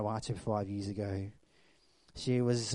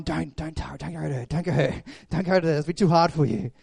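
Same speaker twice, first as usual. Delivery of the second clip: frantic warning; 4 semitones higher, 9 dB louder, faster than usual. Retold or imitated. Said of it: "And I'm going".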